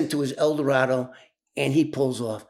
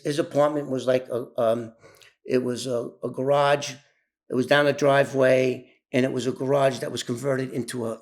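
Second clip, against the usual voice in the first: usual speaking voice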